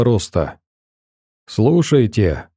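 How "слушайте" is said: ordinary delivery, nothing marked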